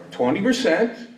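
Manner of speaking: retold disbelief. Retold incredulous reply.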